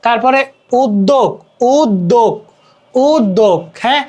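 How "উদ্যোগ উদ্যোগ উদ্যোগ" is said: উদ্যোগ said as "ud-dog", repeated carefully; pronunciation demo. Shown as a demonstration. Showing students how to read it, careful "ud-dog" for উদ্যোগ. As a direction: model উদ্যোগ with a doubled d, pausing between repeats. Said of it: "দেখানোর জন্য দিচ্ছি যে আসলে কিভাবে".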